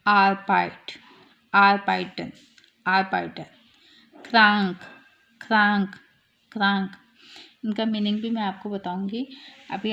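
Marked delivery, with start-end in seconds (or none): 0.1-6.9 आरपाइट, आरपाइटन, क्रांक with a short आ, each word set apart; short vowel. Shown as a demonstration. Teacher showing short sound, brief आ in आरपाइट and क्रांक. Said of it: "अब short sound कैसे?".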